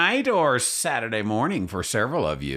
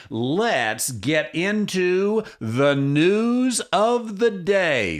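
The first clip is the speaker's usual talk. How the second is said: grand announcement; 7 semitones higher, much slower than usual. Acted. Host introducing the news segment, slow and rising.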